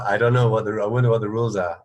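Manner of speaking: usual speaking voice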